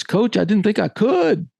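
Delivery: put-on high-pitched kid voice; young player's excuse. Player explaining himself to his coach, high and earnest.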